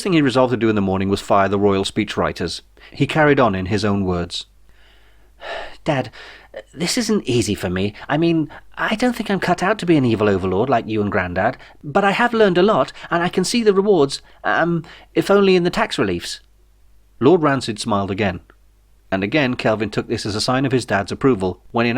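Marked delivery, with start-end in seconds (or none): 5.8-16.4 high, stammering, halting heir; nervous young son. A narrator's reading. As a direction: fumble the rehearsed speech, much higher. Kelvin speaks in his own words.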